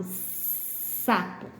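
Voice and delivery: "Sapo" opened with a long voiceless hiss. Shown as a demonstration. Teacher repeating the toad example, long hiss in "Sapo". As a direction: hold the S, then a soft "apo". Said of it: "S do sapo".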